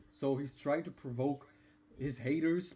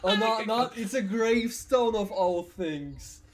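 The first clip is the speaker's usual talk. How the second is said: laughing through the words; cracking up mid-sentence; 7 semitones higher, 8 dB louder than usual. Real, not acted. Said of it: "he's making me laugh at a gravestone".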